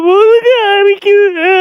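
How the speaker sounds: put-on high, drawn-out baby bawl; baby crying. Baby with an itchy back, mock crying. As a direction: stretch the words into a put-on wail. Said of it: "சரி, கத்துவோம்".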